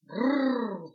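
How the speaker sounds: loud rolled growl; shaggy dog growling loudly at the puppy. Acted. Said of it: "зарычал пёс".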